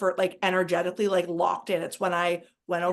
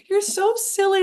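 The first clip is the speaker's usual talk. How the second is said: high, loud, playful self-teasing; teasing herself; 11 semitones higher, 5 dB louder than usual. Retold or imitated. Laughing at herself while crying, she scolds herself high and loud. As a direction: tease yourself like a fond friend. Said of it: "laughing at myself, being like".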